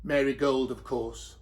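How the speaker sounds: ordinary delivery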